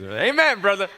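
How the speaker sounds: high, loud church-style amen; mock amen shout. Preacher answering his list of bad things, mock amen shout. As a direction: call it out like a fired-up congregant. Playful church response to himself.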